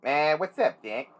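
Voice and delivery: cartoon rabbit impression; put-on cartoon wise-guy voice; Bugs Bunny. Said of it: "Does he talk like Bugs Bunny?".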